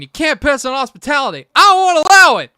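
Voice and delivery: high, very loud mock tirade; screaming dad impression. Host imitating the dad raging at his kid, building scream. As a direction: build to a full hammed-up movie-dad scream. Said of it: "he's just screaming".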